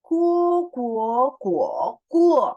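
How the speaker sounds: guo through tones one to four, first and last loud; guo: high, rising, dipping, falling; four Mandarin tones. Shown as a demonstration. Teacher drilling tones on guo. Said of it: "ikutin aku membaca".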